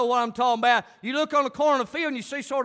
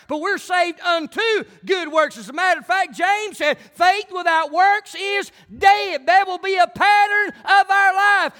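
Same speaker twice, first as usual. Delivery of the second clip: louder, higher preacher's cry; full-force preaching; 6 semitones higher, 6 dB louder than usual. Acted. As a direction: preach it out to the congregation.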